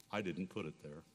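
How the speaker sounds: tono cupo, basso e molto sommesso; grave e raccolto, quasi a voce bassa; somberly. A narrator's reading. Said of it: "somberly said".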